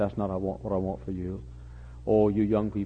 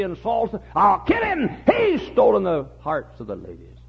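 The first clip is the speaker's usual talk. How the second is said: full-force preaching; 13 semitones higher, 8 dB louder than usual. Acted. Preacher crying out prayers for the young, impassioned. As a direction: pour it out like a desperate prayer.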